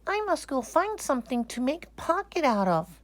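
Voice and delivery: a puzzled toy bear; small teddy bear voice. Acted. Corduroy the bear realizing he has no pocket.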